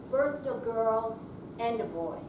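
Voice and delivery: usual speaking voice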